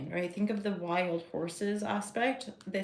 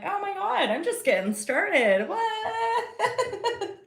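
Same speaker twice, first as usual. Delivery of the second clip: squealed much higher, laughing at the end; mock astonishment; 12 semitones higher, 4 dB louder, much slower than usual. To her viewers, realizing she's just begun, squealing astonishment. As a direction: gasp at her own surprise. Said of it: "I'm like".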